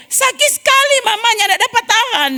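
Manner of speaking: put-on high, pleading cry of a hurting child; child in pain. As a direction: wail to mama that it hurts too much. (anak kesakitan merintih dengan suara tinggi)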